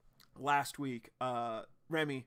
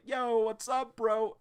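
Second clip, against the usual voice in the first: loud greeting; 9 semitones higher, 6 dB louder, slower than usual. Retold or imitated. Ison bursting in on Blyke.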